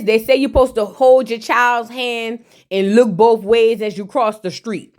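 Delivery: usual speaking voice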